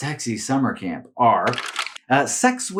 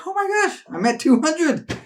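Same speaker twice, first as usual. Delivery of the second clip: much higher, thrilled gasp at the number; excited squeal; 13 semitones higher than usual. Retold or imitated. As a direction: squeal with delight at the milestone. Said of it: "I was like".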